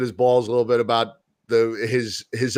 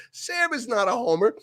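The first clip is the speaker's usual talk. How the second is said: higher but softer repeat of her protest; retold protest; 10 semitones higher, 6 dB quieter than usual. Retelling what Jen said to Chris, softer imitated protest. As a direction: quote her lightly, pitched up and held back.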